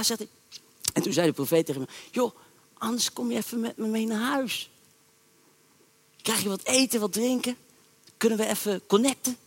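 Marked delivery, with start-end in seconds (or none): 2.1-9.4 soft, friendly coaxing; old prophet softly inviting the young one to dinner; coaxing invitation. Retold or imitated. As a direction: lure him home gently, softer.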